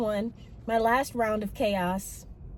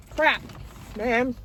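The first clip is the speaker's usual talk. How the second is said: ordinary talk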